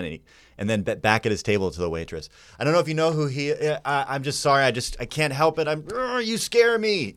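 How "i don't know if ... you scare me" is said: high, stammering apology climbing higher; imitating an intimidated admirer; mock flustered fan. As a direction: stumble upward into a squeaky confession. Quoting flustered scared fan.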